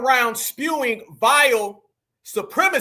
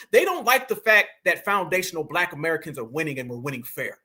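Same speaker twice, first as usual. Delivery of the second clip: ordinary talk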